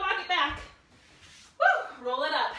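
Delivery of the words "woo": high bright whoop; excited whoop. Real, not acted. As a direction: cheer the class on. Cheering after a set.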